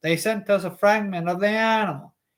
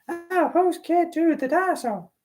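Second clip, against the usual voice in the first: high female voice try; 11 semitones higher than usual. Shown as a demonstration. Reader trying out a woman's voice for Alice. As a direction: pitch way up into a woman's voice. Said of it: "some sort of female voice".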